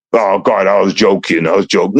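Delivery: low-pitched mock plea to God; mock excuse. Excusing himself to God at judgment, low mock plea.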